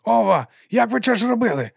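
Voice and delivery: high, loud lisping amazement; excited lisping servant. A narrator's reading. Excited servant reacts to pressure drop.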